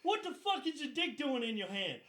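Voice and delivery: loud, high-pitched outraged yelling; chewing-out sergeant. Retold or imitated. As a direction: bellow it like an angry sergeant, hammed up. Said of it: "And he's like".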